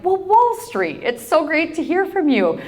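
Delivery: high-pitched, gushing hello; retold delighted greeting. Cowgirl greets her Wall Street buddy, re-enacted delight. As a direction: greet a long-lost buddy, voice lifted high. Greeting a long-lost acquaintance by phone.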